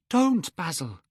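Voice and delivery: high urgent plea; cried out. A narrator's reading. Dorian cries to the painter not to cut it, high. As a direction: stop him with the word. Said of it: "he cried".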